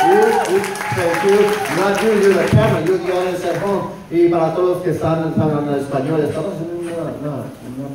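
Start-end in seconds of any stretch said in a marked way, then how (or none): none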